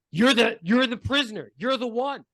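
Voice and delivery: loud, high, stumbling realization; excited recognition. Acted. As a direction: blurt it out as you recognize them. Game master's figure recognizes the prisoner.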